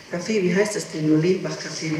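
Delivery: ordinary talk, nothing marked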